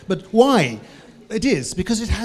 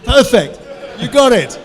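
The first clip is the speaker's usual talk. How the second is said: high, loud burst of praise; excited praise; 6 semitones higher, 7 dB louder than usual. Real, not acted. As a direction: cheer him on for getting it right. Praising a correct audience answer.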